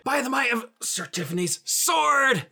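heroic battle cry. Acted.

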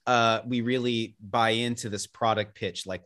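usual speaking voice

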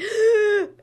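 loud, high gasp of dismay; dramatic gasp. Retold or imitated. Librarian retelling finding road work at her driveway, dramatic gasp. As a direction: gasp as if caught by surprise.

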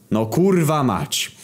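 slow, high-pitched curse; drawn-out despairing oath; collective exasperation. A narrator's reading. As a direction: stretch the swearword out in pure exasperation. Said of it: "powiedzieli chórem".

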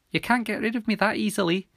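put-on high, croaky girl voice; soft croak. A narrator's reading. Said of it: "croaked Milly, softly".

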